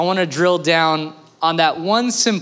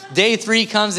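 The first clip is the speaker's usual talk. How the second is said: ordinary talk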